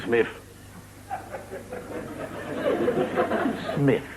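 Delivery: "Smith" said as Smiff; Londoner's TH as F. Acted. London job applicant giving his name, "Smith" as Smiff. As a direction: end "Smith" on an F. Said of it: "the Londoner has trouble with the T and the H".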